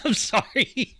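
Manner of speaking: high, helpless laughing apology; real laughter. Real, not acted. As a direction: apologize while cracking up. Apologizes while cracking up.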